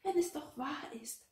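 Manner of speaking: high, sighing protest; a plaintive, high-pitched sigh. A narrator's reading. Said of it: "seufzte Gertrud".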